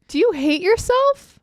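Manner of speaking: high, slow incredulous tease; mock outrage. Teasing her friend about plain tea, mock outraged. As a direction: act scandalized at her order.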